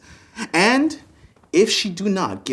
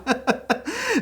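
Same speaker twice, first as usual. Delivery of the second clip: laugh; 10 semitones higher, 6 dB quieter than usual. Acted. Gloating over his plan.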